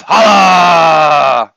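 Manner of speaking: loud hype-man shout; big one-word crowd shout; holla shout. Acted. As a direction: yell it out to the crowd.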